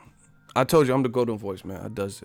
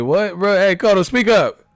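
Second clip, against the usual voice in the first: high loud call to speak up; mock yell; 9 semitones higher, 9 dB louder than usual. Fan yelling at whispering Cotto, loud mock call. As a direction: shout it across the room.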